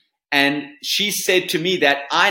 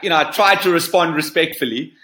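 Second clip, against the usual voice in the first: normal talking voice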